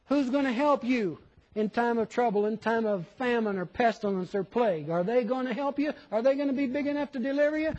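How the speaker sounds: high-pitched pulpit challenge, piling up; impassioned preaching. Real, not acted. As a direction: fire off each question with rising urgency. Preacher's mounting rhetorical questions.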